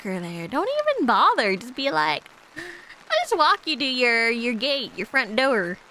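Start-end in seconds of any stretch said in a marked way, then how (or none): 2.6-5.8 slow, higher line with a Canadian slip; walk-you-home offer in a Canadian accent; Canadian-tinged gentle offer. Acted. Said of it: "The Canadian slipped out there".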